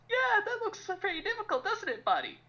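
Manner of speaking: a high, hammed-up patronizing guy; mocking patronizing man. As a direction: play the smug guy humoring his pal. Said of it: "they would just stand there and go".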